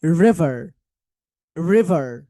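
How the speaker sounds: "রিভার" low, both Rs sounded; loud, low "রিভার" with rounded Rs; American R. Shown as a demonstration. Teacher drills R at both ends, American R. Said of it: "আর দিয়ে শুরু হয় এবং আর দিয়েই শেষ হয়".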